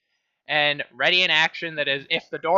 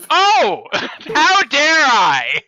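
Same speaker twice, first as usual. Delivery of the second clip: loud, high, drawn-out indignation; mock outrage; 8 semitones higher, 7 dB louder, much slower than usual. Rat's player throws a teammate's jibe back, mock outraged. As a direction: exclaim in hammed-up offence, stretching it out.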